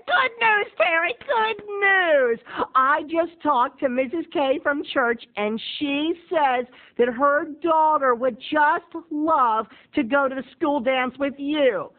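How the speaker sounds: high, slow sing-song mom; gushing mom voice. Retold or imitated. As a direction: gush like an excited mother.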